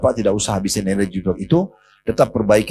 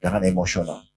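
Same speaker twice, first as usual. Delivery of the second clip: low, soft and calm; calm, quiet prophet; 6 semitones lower, 6 dB quieter than usual. A narrator's reading. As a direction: settle an angry friend gently. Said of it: "Nabi dengan tenang memberikan isyarat".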